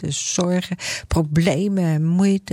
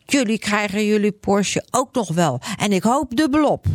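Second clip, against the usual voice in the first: higher, spiteful Grietje voice; Grietje calling back; 4 semitones higher than usual. Acted. Grietje hitting back at the name-callers, higher and spiteful. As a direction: fire the curse back at them. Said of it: "En ze roept".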